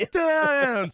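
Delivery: hammed-up Italian cry; mock Italian. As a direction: belt "Italian" up high. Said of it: "They're like".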